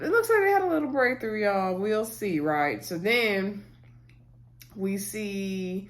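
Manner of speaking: normal talking voice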